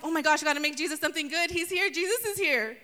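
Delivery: mock frantic hostess racing to cook for Jesus; frantic excitement. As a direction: rattle it off in a panic to feed Jesus. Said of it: "running around frantically".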